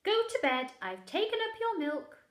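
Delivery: high, loud call. Acted. Mother calling bedtime upstairs, loud. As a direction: call up the stairs.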